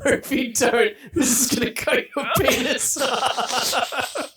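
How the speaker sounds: half-hearted Saw puppet voice, laughing through it; a laughing, lightly voiced horror villain; mock Jigsaw voice. Friend hams up Jigsaw threatening George to finish his books, laughing. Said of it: "You're not even doing a Saw voice".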